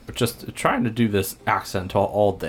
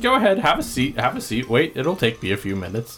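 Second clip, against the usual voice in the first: a hint of a hoarse barkeep, a touch higher; lightly voiced bartender Jim; 10 semitones higher than usual. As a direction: play the tired bartender, lightly. GM voicing Jim Tambor.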